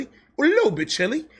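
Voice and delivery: small playful emphasis on little; stressing little. Shown as a demonstration. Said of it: "It wasn't crazy chilly".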